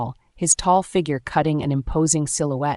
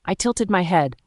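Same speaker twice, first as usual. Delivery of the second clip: usual speaking voice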